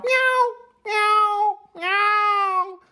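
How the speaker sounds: high, repeated kitten meows; insistent kitten meows. Acted. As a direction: mew over and over for attention. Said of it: "The kitten started to meow".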